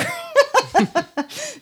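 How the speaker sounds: high, loud burst of giggling; real laugh. Real, not acted. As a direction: break up laughing at yourself. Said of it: "Sorry, I shouldn't laugh".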